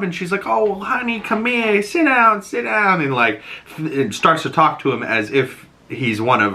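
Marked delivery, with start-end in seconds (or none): 0.5-3.0 high, sweet, doting mother voice; much higher, motherly "oh honey" voice; sweet young mom. Retold or imitated. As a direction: coo it like a confused mom fussing over her boy. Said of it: "seemed like this sweet young mom".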